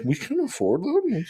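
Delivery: high-pitched mock wife voice; imitating wife. Wife questioning the dinner bill, imitated in high voice. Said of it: "Wife's like".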